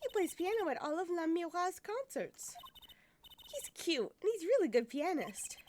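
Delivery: put-on high, chirpy girl's voice; admiring girl character. Girl fan describing the pianist, gushing. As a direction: gush like a young fan.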